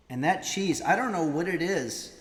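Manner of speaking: normal talking voice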